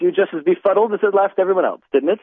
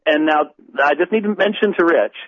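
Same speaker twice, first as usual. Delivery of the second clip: ordinary talk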